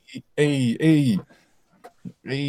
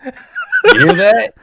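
mock showman; 10 semitones higher, 7 dB louder, much slower than usual. Mock crowd bit.

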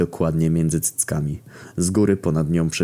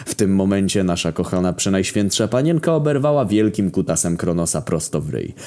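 ordinary delivery

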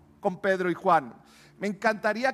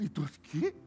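softer, unimpressed casual tone; blasé shrug; 11 dB quieter than usual. Shown as a demonstration. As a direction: act like it happens every day. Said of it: "es lo normal". (indiferente y casual)

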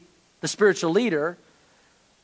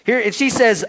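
ordinary delivery

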